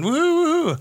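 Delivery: high whooping cheer. Acted. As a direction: whoop along with the crowd. Cheering in the bar.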